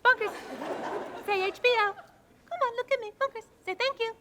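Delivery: coaxing the dog. Acted. Coaxing her dog to speak.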